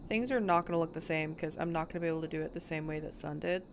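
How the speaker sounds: ordinary talk, nothing marked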